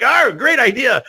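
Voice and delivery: high, drawn-out cry of sudden inspiration; mock delight. Speaker recalling buyers throwing credit cards at him, mock delight. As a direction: stretch it out high, like a eureka moment.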